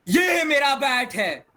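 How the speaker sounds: loud, high-pitched drawn-out exclamation; changed tone. Shown as a demonstration. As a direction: shout it out high and slow. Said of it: "देखो, कितना difference हो सकता है". (ऊँची आवाज़ में खींचकर ज़ोर से)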